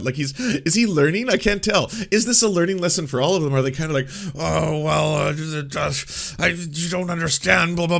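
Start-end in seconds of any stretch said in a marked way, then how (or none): 4.4-8.0 mock befuddlement. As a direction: stumble along as if hopelessly confused. Mimics others feigning confusion.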